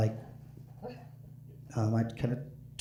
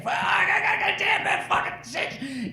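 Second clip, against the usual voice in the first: very high, strained yelling in a cramp; drunk bandleader yelling; 4 dB louder, slower than usual. Retold or imitated. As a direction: screech it like a drunk with a leg cramp. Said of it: "He's like".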